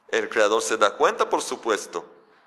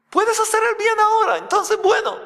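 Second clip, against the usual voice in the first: much higher and louder, eager encouragement; encouraging Creator; 4 dB louder than usual. Acted. The Creator urging Cain to do good, high and eager. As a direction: urge him on, bright and lifted. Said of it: "le dice lo siguiente".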